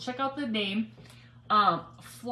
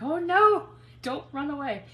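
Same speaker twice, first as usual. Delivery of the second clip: alarmed cry; 6 semitones higher, 5 dB louder than usual. Retold or imitated. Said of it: "I was like".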